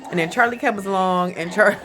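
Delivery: usual speaking voice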